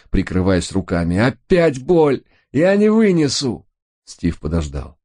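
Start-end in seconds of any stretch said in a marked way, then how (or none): 1.2-3.7 high, drawn-out agonised wail; pained scream. A narrator's reading. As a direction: wail that it is unbearable. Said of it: "закричал Рой". (вопит от боли)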